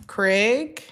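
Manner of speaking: loud high call of Craig. Pretend. Calling the cheating partner, loud and high. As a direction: call him out loudly across the room.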